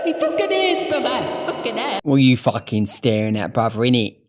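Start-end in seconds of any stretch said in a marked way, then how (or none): 0.1-2.0 high, cheeky put-on voice; cheeky mock voice. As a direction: play a cheeky lad. Mocking a rough bloke.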